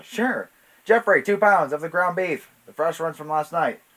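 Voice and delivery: loud, high shopkeeper's shout to the back; calling out an order. Acted. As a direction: holler the order across the shop. Martha calls order to Jeffrey.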